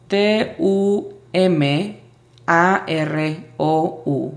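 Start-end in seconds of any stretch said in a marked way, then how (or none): none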